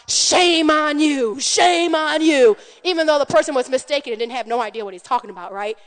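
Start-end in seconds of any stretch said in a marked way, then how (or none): 0.1-2.6 scolding. Retold or imitated. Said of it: "said to Pastor Josh".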